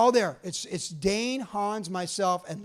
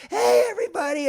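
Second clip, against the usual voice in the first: classic Jerry greeting; 7 dB louder than usual. Retold or imitated. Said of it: "like classic Jerry".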